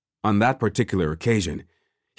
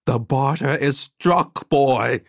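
put-on slow, raised old woman's voice; firm old woman; 5 semitones higher, 3 dB louder, slower than usual. A narrator's reading. Old woman tells the boy it is settled, firm. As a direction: declare it final, slowly and high.

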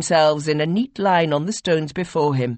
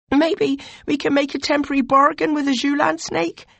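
higher, louder, urgent pleading; anxious young advisor; 13 semitones higher, 4 dB louder than usual. Acted. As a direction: press your lord with dread and worry. Voiced "you" character pleads with lord.

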